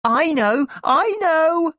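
put-on excited little girl bursting to tell; excited little sister. A narrator's reading. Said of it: "said my little sister excitedly".

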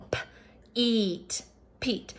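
p, eat, peat blended softly sound by sound. Shown as a demonstration. Teacher blending p, eat into peat for the class. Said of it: "makes that middle vowel sound say E".

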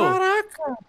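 high, drawn-out cry of amazement; amazed exclamation. Real, not acted. Watching the game creature attack, amazed. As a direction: blurt it out high in disbelief.

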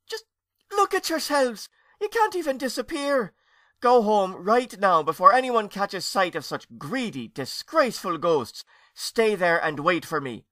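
high, loud scolding; aunt scolding the greedy ghosts, high and loud. Acted. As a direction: scold them shrilly from on high.

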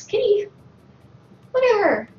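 high excited cat call. Real, not acted. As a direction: call the kitty with delight.

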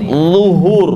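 LUHUR slowly with a heavy buzzed onset; thick zo. Shown as a demonstration. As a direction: hold the thick first sound of LUHUR. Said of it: "Nanti coba dibiasakan". ("luhur" dengan awal tebal)

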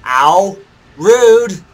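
loud, high yelp of offence; indignant outcry. Real, not acted. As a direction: yelp as if hit, then scold loudly. Hit in game.

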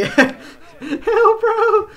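high, loud laughing cry; laughing disbelief. Real, not acted. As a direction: yell it through a laugh. Laughing at an amazing play.

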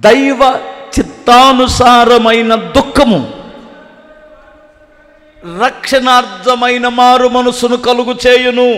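slow, loud solemn reading of the verse; scripture reading. Acted. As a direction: read the verse out slowly and gravely. Reads scripture verse in sermon. (నెమ్మదిగా గంభీరంగా వాక్యం చదవడం)

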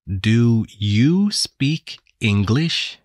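slow and careful, each word apart; slow speech. Acted. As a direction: spell it out slowly for a foreigner. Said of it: "very slowly".

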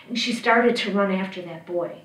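ordinary delivery, nothing marked

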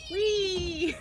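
high gleeful squeal; excited whee. Real, not acted. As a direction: let out a delighted whee. Riding a launch thing.

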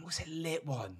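whispered to himself; whispering. Real, not acted. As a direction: murmur it under your breath. Thinking aloud, barely audible.

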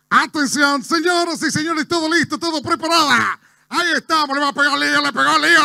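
high, loud football commentator building up; Mariano Clos. Acted. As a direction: build excitedly toward a goal shout. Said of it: "Mariano Clos, que no se le entiende mucho". (relator de fútbol, agudo y cada vez más exaltado)

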